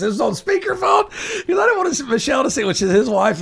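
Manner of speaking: high, loud burst of storytelling; high, loud excited telling of the speakerphone moment; excited retelling. Real, not acted. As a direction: squeal it out laughing at the memory. Friend on speakerphone, retold excitedly.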